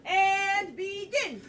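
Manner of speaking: loud, stretched-out launch; drawn-out starting cue. Real, not acted. Host cueing the new genres to begin, drawn-out call. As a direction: call the start slowly and big.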